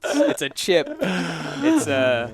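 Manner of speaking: put-on loud teasing British accent; mock British. Host listing British words at friend, loud mock British accent. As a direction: rattle off British words, hammed-up. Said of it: "A British person would never know".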